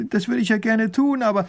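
high, anxious protest; desperate pleading. A narrator's reading. Widow answers the minister before being cut off, pleading. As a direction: start pleading, pitched high.

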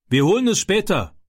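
put-on high-pitched call back to the boys; calling out loudly. A narrator's reading. Man calling the boys back from chasing the boat, calling out. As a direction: call it out, pitch well up, without real shouting. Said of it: "rief sie mit lauter Stimme zurück".

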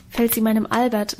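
plain reading voice

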